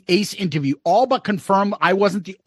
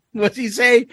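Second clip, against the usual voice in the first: ordinary talk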